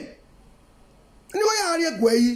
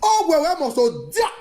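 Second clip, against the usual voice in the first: ordinary delivery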